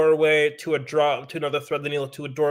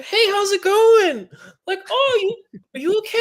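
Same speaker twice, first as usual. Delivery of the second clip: high, bright-toned mock greeting from the organizer, louder; 7 dB louder than usual. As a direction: play the organizer greeting, pitched way up. Organizer greeting a limping rider.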